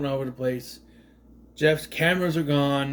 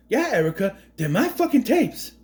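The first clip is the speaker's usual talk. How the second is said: higher, hammed-up Boston tough guy; Mark Wahlberg impression; 6 semitones higher than usual. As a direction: spit out the tapes line like a Boston hothead. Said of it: "I would love Mark Wahlberg to play this role".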